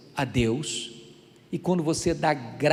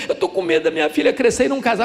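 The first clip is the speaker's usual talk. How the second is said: mock worried mother; 4 semitones higher, 6 dB louder, faster than usual. Mother's tearful worry.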